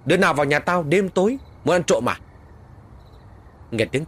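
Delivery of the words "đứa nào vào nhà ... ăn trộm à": loud, high shout at intruders; yelling. Acted. Boy shouts at children lurking by the fence, loud yell. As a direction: bellow at the kids behind the fence. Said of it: "Tôi quát lên".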